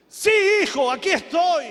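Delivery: high, loud, resounding answer; acted divine reply, projected; voice from heaven. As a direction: call back as God to a stranded son. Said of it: "siente una voz que le dice".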